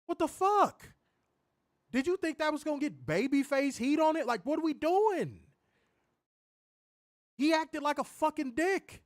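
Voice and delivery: high-pitched, worked-up Chicago accent. Real, not acted. Podcaster ranting about a wrestler's act, heated Chicago accent. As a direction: rant in an indignant Chicago accent. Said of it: "This shit got my Chicago accent coming out".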